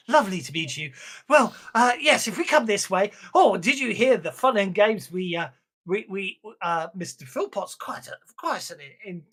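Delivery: lightly acted gushing hostess; effusive show hostess. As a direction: gossip brightly to new guests. Plays Miss Adams chatting effusively.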